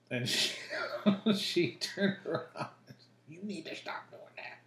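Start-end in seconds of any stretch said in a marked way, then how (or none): 3.3-4.6 imitating mom's hush. Mom hushing him in store.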